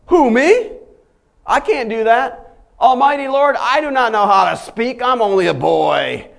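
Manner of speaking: high, loud pleading protest; protesting Jeremiah. Acted. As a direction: play a frightened young prophet begging off. Preacher acts Jeremiah's protest.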